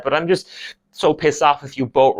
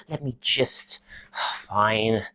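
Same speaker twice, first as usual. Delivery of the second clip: resigned; 2 semitones lower, 8 dB quieter, slower than usual. Acted. Student corrected by lecturer, quietly resigned. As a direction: give in under your breath.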